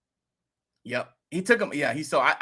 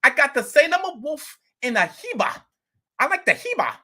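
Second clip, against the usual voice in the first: bragging about his coin, pumped up; imitating the neighbor; 8 semitones higher than usual. Neighbor bragging to the vlogger about his coin, excited higher voice.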